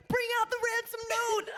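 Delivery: high, eager call-out; mock excited demand. Friend hyped at the documentary, high mock call. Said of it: "you're like".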